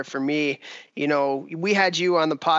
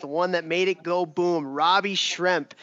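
ordinary delivery

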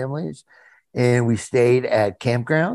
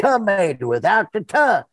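Mater inflection; 6 semitones higher than usual. Shown as a demonstration. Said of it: "just to get the inflection".

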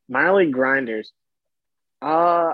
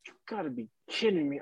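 muted disbelief; 2 semitones higher, 10 dB quieter, faster than usual. Retold or imitated. Retold disgusted reaction under his breath.

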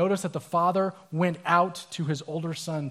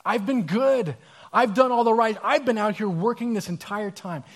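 louder, higher self-righteous complaint; loud, higher, indignant protest of the dutiful one; resentful; 5 semitones higher, 6 dB louder than usual. Acted. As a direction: insist you've earned it, aggrieved and loud.

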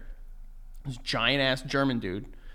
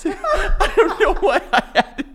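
high and slow, laughing; 7 dB louder than usual. Real, not acted. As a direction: giggle through the words.

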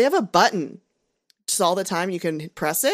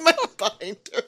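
ordinary talk

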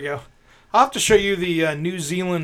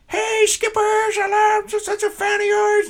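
very high, gushing fake fan voice; prank caller voice; 3 dB louder than usual. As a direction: squeal like an overexcited fan. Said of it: "I went".

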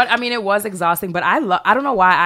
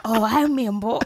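Member Berries voice; 4 semitones higher, 4 dB quieter than usual. Retold or imitated. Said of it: "member berries".

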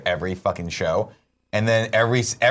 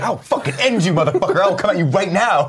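higher, louder mock threat; retold snap; 10 semitones higher, 4 dB louder than usual. Retelling a TV character snapping at a guy, mock furious. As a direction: snap like a docile guy turning monstrous. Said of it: "he snaps on the guy".

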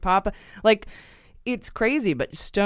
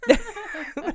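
squeaky high burst of laughter. Real, not acted. Host laughs at his own baseless claim. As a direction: crack up at yourself.

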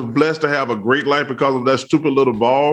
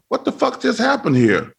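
startled outburst; 8 semitones higher than usual. Retold or imitated. Retold being soaked by drink.

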